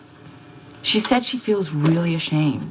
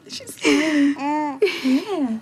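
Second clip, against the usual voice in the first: delighted; 12 semitones higher, much slower than usual. Acted. Delighted by smiling baby.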